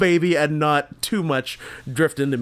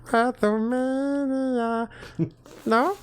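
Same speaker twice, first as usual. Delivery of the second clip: put-on high slow baby voice; 9 semitones higher, 4 dB quieter, slower than usual. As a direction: stretch it out like a toddler. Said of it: "stopped sounding like a baby".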